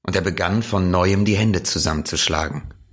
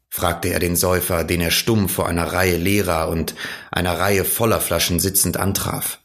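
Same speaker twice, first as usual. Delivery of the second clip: ordinary delivery, nothing marked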